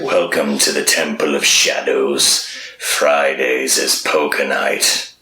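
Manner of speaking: low, slow deadpan villain voice; deadpan evil greeter. Retold or imitated. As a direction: greet them like a bored cultist. Temple of Shadows guard greets.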